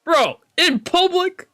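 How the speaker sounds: high, slow scandalised bro; mock outrage. Real, not acted. Streamer teasing the characters for kissing, mock scandalised. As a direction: stretch it out, pretend appalled.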